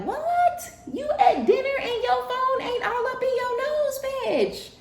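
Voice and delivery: very high, giddy astonished squeal with laughter; mock amazed squeal. Partner amazed at a phone-free dinner date, very high giddy squeal. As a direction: squeal it way up high, delighted and shocked.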